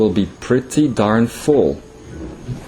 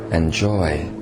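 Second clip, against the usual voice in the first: guided meditation; 3 semitones lower, 5 dB quieter than usual. Acted. Channeled king leading listeners into meditation, soft guiding voice. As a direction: ease the listeners into letting go.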